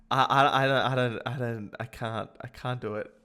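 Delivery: normal talking voice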